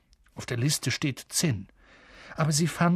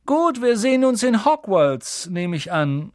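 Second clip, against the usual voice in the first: put-on high, loud boy's voice; drawling boy; 7 semitones higher, 6 dB louder than usual. A narrator's reading. Boy saying goodbye to Harry at the shop, drawling. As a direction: play a drawling boy, pitched up. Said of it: "der Junge mit der schleppenden Stimme".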